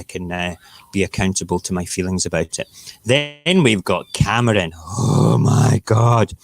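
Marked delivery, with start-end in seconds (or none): 4.7-6.3 exasperated. Real, not acted. Groaning at Cameron.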